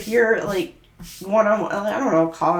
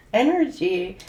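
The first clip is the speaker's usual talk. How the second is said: ordinary delivery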